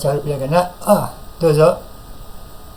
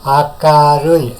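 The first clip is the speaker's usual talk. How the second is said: "Akarui" drawn out slowly, syllable by syllable; slow model word; 4 dB louder, much slower than usual. Shown as a demonstration. Teacher slowly models "Akarui" for the class to repeat. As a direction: stretch "Akarui" so students can copy. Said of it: "coba minasang ikuti ucapan saya".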